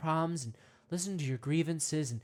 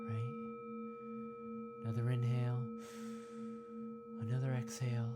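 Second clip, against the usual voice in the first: guided meditation; 5 semitones lower than usual. Acted. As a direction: ease the listener in gently, mock-serene.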